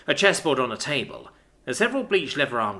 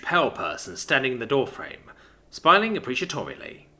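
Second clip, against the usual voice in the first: ordinary delivery, nothing marked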